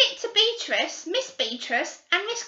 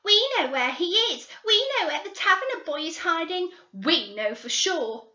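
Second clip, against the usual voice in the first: put-on high, loud breathless excitement of young girls; excited girls; 8 semitones higher, 9 dB louder than usual. Girls bursting in on the detectives, high and loud and excited. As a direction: burst in crowing the news. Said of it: "came excitedly into the room".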